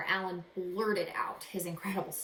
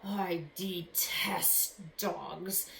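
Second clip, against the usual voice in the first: growled threat; much slower than usual. Acted. Magician growling at the boy about dogs. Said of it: "a voice that was more like a growl".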